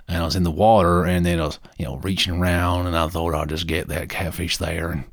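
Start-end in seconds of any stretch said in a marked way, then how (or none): none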